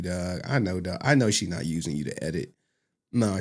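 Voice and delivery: ordinary talk, nothing marked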